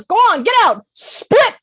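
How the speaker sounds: high-pitched, urgent shooing; excitable bartender's yell; acted chasing-out. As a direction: shoo them out of the bar. Joke: bartender ejects beavers.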